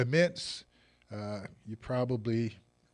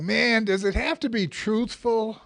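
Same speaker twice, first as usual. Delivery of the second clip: high, loud joking complaint; hammed-up whine of protest; mock dismay; 10 semitones higher, 7 dB louder than usual.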